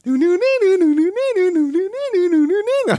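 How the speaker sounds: high-pitched cackling laugh, gasping; squealing laughter; high shrieking laugh. Real, not acted. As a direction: laugh helplessly in a shrill cackle. Cracking up at music.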